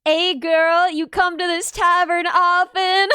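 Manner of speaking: loud, slow, hammed-up flirting; mock pick-up line. Listener teasing the storyteller with an imagined tavern pick-up, hammed-up flirting. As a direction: drawl a cheesy tavern come-on. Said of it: "I pictured it would be like".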